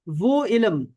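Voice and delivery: slow, measured definition for students; slow dictation. Shown as a demonstration. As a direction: give it out slowly, phrase by phrase.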